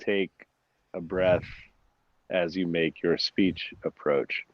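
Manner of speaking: slow, low and soft with long pauses; measured speech delivery, pausing between phrases; wedding speech cadence. Shown as a demonstration. Guest recalling his talk's cadence, slow, measured wedding-speech delivery. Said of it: "that wedding speech".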